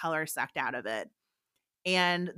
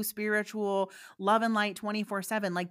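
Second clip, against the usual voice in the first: normal talking voice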